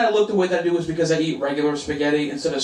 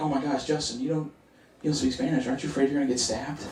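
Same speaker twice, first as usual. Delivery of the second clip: soft, worried friend imitation; hushed mock concern; 7 dB quieter than usual. Friends worry about his Dominican neighborhood, in hushed voices.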